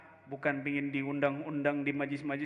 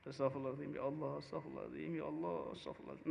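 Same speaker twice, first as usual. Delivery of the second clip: hushed, rushed tearful istighfar, softer; weeping repentance; 4 semitones lower, 12 dB quieter, much faster than usual. Acted. Sinful scholar repenting before Allah, hushed and tearful. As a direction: beg forgiveness through tears, softer and quick. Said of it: "Nangis dia".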